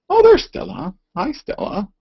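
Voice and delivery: high, loud cooing hello to the dog. Real, not acted. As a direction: greet the dog in a sing-song. Greeting the dog.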